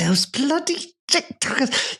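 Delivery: very high, slow comic voice; hammed-up high mock outrage; silly squeal. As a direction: squeeze it up into a drawn-out squeal. Repeats his silly voice on cue.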